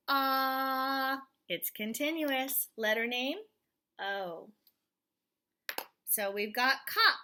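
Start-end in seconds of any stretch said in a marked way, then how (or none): 0.1-1.2 long loud ah; held ah sound. Shown as a demonstration. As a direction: sustain the ah vowel. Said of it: "stopping or continuous?".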